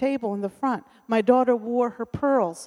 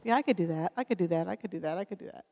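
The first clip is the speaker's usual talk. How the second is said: each repeat softer until nearly gone; fading yes; 2 semitones lower, 7 dB quieter, much faster than usual. Pretend. Preacher voicing someone agreeing to everything, fading softer. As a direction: let it fade away to nothing. Overcommitting person saying yes.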